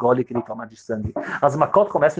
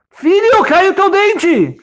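much higher, louder, very slow alarmed cry; panicked father; 6 dB louder, much slower than usual. Acted. Father alarmed at his bleeding son, high panicked cry. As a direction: stretch it out in panic.

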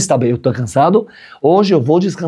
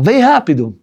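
urgent call; 8 semitones higher, slower than usual. Retold or imitated. Wife calling him urgently.

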